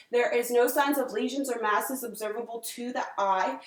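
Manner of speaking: clinical dictation. Acted. Dictating exam findings aloud.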